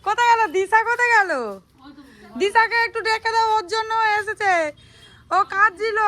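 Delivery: high, loud call across the house. Real, not acted. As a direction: call out for Disha so she hears. Calling out for Disha at house.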